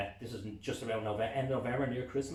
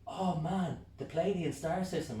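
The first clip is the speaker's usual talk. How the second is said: higher, louder oh man; excited; 8 semitones higher, 4 dB louder, slower than usual. Retold or imitated. Stargazer spotting the Pleiades, excited. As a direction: burst of excitement.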